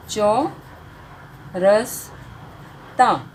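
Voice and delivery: चौरसता stretched very slowly syllable by syllable; teacher pronouncing चौरसता very slowly; slow sounding out. Shown as a demonstration. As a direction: blend चौरसता slowly for learners. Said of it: "साथ साथ में pronounce करना भी सीख रहे हैं".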